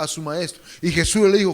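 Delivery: ordinary talk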